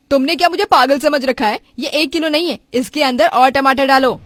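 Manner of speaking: loud, indignant demand; angry customer. Acted. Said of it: "customer को इतना गुस्सा होकर".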